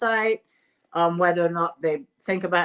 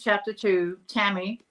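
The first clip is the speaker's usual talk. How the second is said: ordinary delivery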